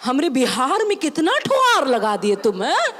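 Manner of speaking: indignant Bihari papa; Bihari father. Retold or imitated. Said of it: "And he's like".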